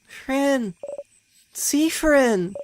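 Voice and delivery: high friendly calling voice; bright wake-up call from a friend. Acted. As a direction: call him awake like a cheerful friend. Mirabelle calling him awake.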